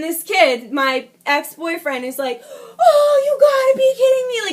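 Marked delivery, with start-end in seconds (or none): 2.8-4.5 high, loud, hammed-up panicked whine; mock a man losing it as he's caught; imitated freaking out. Imitating ex-boyfriend about to be caught, loud mock freak-out. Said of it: "he's freaking out".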